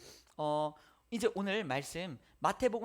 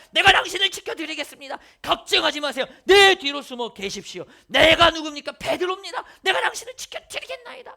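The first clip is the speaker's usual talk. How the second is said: loud, much higher hammed-up boasting; boastful Peter; 7 semitones higher, 15 dB louder than usual. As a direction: swagger like a proud disciple showing off. Boastful Peter pledging loyalty.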